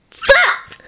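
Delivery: put-on very high, bright little-child squeal; a toddler thrilled with a new word; child chirping gleefully; delighted chirp. A narrator's reading. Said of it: "Annie chirped delightedly".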